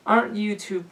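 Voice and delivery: put-on high cooing older woman. A narrator's reading. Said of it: "she cooed softly".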